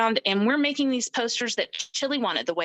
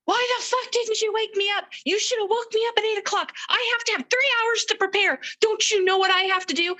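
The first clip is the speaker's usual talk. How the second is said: much higher, loud furious yelling; imitating a raging houseguest yelling from the basement; mock roar; 12 semitones higher, 3 dB louder than usual. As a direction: roar it like an enraged man just woken. Retelling Chili roaring at her.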